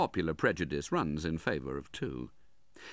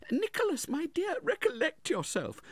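very high, pleading woman's voice; remonstrating mother. A narrator's reading. Mother calms her angry son, remonstrating. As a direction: gently check your son, pitched far up. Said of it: "remonstrated Missus Nickleby".

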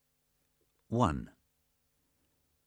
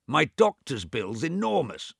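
put-on cranky old man; 7 semitones higher, 4 dB louder than usual. Swithin replies.

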